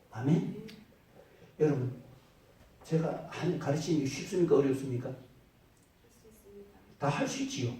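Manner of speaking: loud, rising sermon delivery; preaching. Acted. Pastor preaching to the congregation, in a loud sermon voice.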